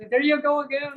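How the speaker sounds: high-pitched imitated ribbing; playful high mimicry of teasing; mock teasing. They're like.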